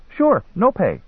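put-on high-pitched boy agreeing. A narrator's reading. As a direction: agree like a young hustler.

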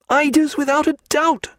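very high, excited declaration; bird-watcher's keen exclamation; delighted certainty. A narrator's reading. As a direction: announce the sighting in a high, thrilled voice. Bird-watcher identifies the awaited ducks.

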